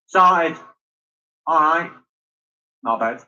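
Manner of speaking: Mancunian three-word demo; Manchester accent. Shown as a demonstration. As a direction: say each word apart in Mancunian. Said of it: "accent's pretty easy for Manchester".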